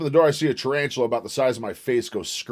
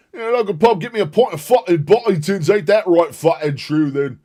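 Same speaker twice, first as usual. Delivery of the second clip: hammed-up British accent, higher and louder; mock British accent; 7 semitones higher, 5 dB louder than usual. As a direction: play a rowdy British lad in a British accent. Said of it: "to do a British accent".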